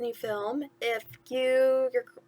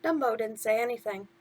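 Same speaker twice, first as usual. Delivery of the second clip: plain reading voice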